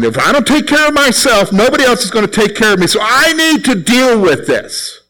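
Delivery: mock self-talk. Voicing self-reliant attitude.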